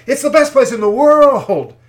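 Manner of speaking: loud, high preacher's outcry; emphatic shout. Real, not acted. Preacher rebutting the devil's lies about America, shouting.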